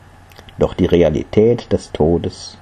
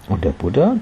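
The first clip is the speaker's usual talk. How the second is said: ordinary delivery, nothing marked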